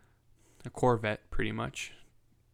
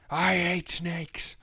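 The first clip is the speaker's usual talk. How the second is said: slow, higher, louder movie-quote impression; Samuel L. Jackson; 7 semitones higher, 5 dB louder, much slower than usual. Dad compared to an action hero hating snakes, slow hammed-up quote. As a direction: deliver it like a film line, drawn out. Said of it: "Samuel L. Jackson in Snakes on a Plane".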